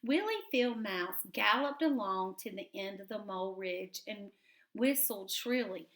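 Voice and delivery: ordinary delivery, nothing marked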